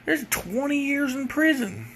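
mocking lawyer voice. Lawyer reads the statute to the kid in a high mocking voice. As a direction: deliver the grim statute absurdly. Lawyer mocked in retold story.

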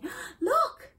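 loud high excited call; calling out look. Shown as a demonstration. Teacher calls out to get someone's attention, excited shout. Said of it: "¿Quieres llamar la atención de alguien?".

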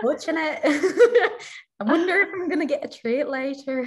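high, lilting Irish dog voice; light Irish accent. Retold or imitated. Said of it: "kept doing Irish voices for".

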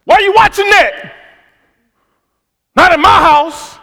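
loud, high scolding, slowing on the last words; husband scolding his wife over her TV show; controlling husband. Retold or imitated. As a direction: scold her like the owner of the house. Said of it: "I literally thought I owned everything".

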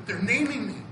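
normal talking voice

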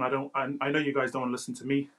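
ordinary talk

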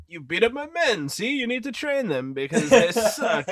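lightly voiced villain, pitched high; hinted movie villain. Villain telling Grandpa to train the kids, lightly mocked.

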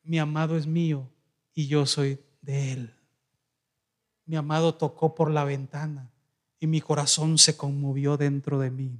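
soft, low and tender, like someone in love; lovestruck. Acted. Preacher reading the Song of Songs as a lover, softly tender. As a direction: read it as a lover longing for the beloved. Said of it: "fíjese cómo habla una persona enamorada".

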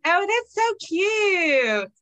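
slow high gush over something cute. Real, not acted. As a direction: draw it out, delighted and high. Gushing over pajamas.

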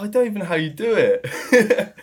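high, loud laughing protest; laughing helplessly at own failed attempt. Real, not acted. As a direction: talk through real laughter.

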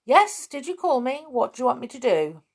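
quick, high-pitched eager reply. A narrator's reading. Bear answering when called, eager. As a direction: answer the call brightly and fast.

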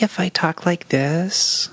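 imitated sweet baby talk. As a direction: put on a drawn-out, cutesy baby voice. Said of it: "doing a baby talk voice".

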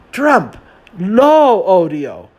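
high, slow, loud telenovela finale; milk it like a soap-opera climax; very dramatic. Retold or imitated. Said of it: "said very dramatically".

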